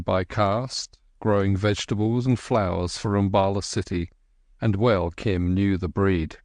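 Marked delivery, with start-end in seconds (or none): none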